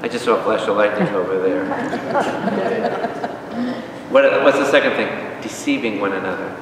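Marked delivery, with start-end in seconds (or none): 1.7-4.1 airy laugh. Real, not acted. Joke about lightning.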